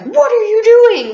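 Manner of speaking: dad yelling as she climbs out window; alarmed shout. Retold or imitated. Dad grabbing her, alarmed.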